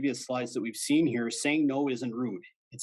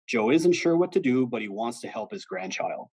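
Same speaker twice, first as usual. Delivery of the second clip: ordinary delivery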